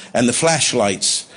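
ordinary talk

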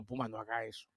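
soft confiding murmur; hushed words in the president's ear; into his ear. Retold or imitated. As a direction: lean in and say it softly in his ear. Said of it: "trato de hablarle al oído".

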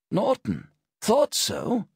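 put-on high croaky old man; rusty voice unused all day. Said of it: "with the croaky voice of someone who hasn't spoken".

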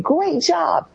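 high, bright-pitched great job; generic praise. Retold or imitated. Parent praising a drawing, high sing-song. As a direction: toss off cheery praise. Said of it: "instead of saying".

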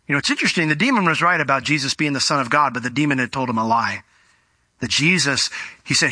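normal talking voice

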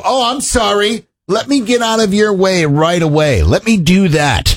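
drawn-out sarcastic sorry; over-accommodating, high at first and slowly settling; mock apology. Co-host mocking answer to a knocker, sarcastic apology. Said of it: "what is it you expect them to do?".

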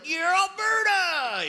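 very high, drawn-out mock cheer; hammed-up Alberta pride. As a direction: whoop it long and high as a proud local. Albertan seen from inside province.

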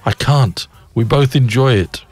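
a hint of an old man pleading back; gruff old man. A narrator's reading. Old man answering the priest, lightly voiced protest. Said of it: "replied the gruff old voice".

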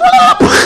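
sudden high mock laugh, loud; high shrieking laugh. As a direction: shriek it out like a cartoon villain. Mock explosion after threat.

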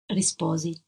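plain reading voice

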